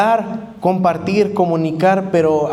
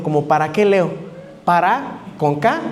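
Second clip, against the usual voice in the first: normal talking voice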